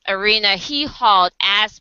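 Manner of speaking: normal talking voice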